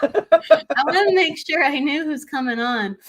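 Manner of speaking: laughing while speaking. Real, not acted. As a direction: giggle through the line. Laughing while searching schedule.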